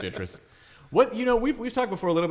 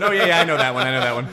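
louder, laughing smug voice; mock smug comic; 7 dB louder, faster than usual. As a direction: play the comic who gets his own joke. Mocking duo getting own jokes.

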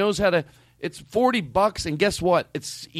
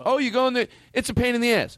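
mock griping, pitched up; imitated complainer; 7 semitones higher, faster than usual. Host mimicking people who gripe about booking flights, mock complaining. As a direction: put on a complainer's higher voice. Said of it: "I've seen people that claim".

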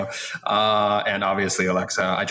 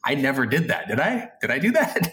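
higher-pitched, incredulous self-quote; mock bewilderment; 5 semitones higher than usual. Guest re-enacting his reaction to forgotten storylines, mock bewildered. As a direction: play up being baffled, questions leaping high. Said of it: "I'm like".

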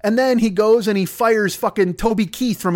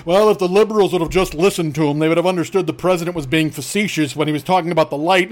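lower, whiny mocking voice; low sneering impression of a defender; mock apologist; 5 semitones lower than usual. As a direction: imitate a smug defender, lightly. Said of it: "everybody does fucking cartwheels to explain".

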